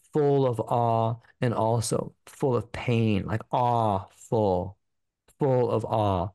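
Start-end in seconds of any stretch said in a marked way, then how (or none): 3.5-4.8 awful drawn out very slowly and low, weighing the word; stress on awful. Shown as a demonstration. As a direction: linger on "awful" slowly to draw out both meanings. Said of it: "awful in both senses of the word".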